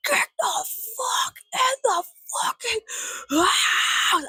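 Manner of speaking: high, raspy scream, slow and broken up; strained high shriek opening up on the last word; mock neighbor screech. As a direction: imitate a neighbor screeching from the yard. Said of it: "my neighbor goes".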